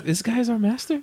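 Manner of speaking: high, soft mock dog voice; dog's thoughts. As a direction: voice the dogs doubting their master. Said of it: "It's like".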